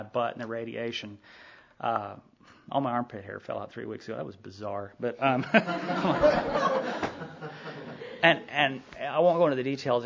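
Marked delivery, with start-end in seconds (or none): 5.5-8.2 real laugh. Real, not acted. Laughs at his own bizarre story.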